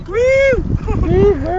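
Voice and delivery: high, bright whoop of delight; excited whoop. Real, not acted. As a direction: whoop at the bird. Excited at seeing bird.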